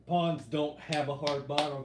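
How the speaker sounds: talking through a yawn; words stretched by a yawn. Real, not acted. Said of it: "I don't know why I'm yawning".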